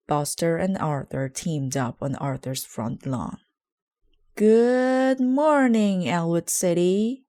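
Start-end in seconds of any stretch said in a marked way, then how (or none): 4.3-7.2 loud, slow, drawn-out announcement; megaphone shout. A narrator's reading. Buster greeting the whole town through a megaphone, loud. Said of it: "Buster shouted into a megaphone".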